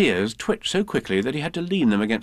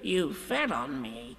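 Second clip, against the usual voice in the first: squashed complaint; 3 semitones higher, 7 dB quieter, slower than usual. Acted. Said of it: "said Piglet".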